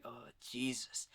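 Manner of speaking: a hushed, slow, stunned Gordon aside; Gordon's reaction. Retold or imitated. Police commissioner reacting to the beaten guy, hushed and slow. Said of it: "Gordon's reaction is just like".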